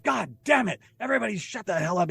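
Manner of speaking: high-pitched mock yell without much volume; exasperated high scolding, acted out; retold yelling. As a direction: imitate her screaming at everybody. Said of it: "yelling, screaming".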